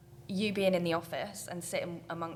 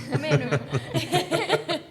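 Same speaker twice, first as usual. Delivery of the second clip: higher, louder mock welcome, laughing; imitating the boss; 9 semitones higher, 11 dB louder than usual. Coworker mimics the boss greeting the team, hammed-up call. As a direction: ham up the boss's cheery call. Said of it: "Maybe not with a Scottish twang".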